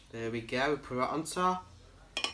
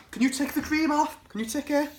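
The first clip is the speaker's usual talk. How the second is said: normal talking voice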